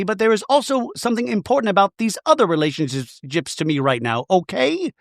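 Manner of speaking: high-pitched imitation of an exasperated wife; mock pleading wife. Voicing Bronwyn pleading with Todd.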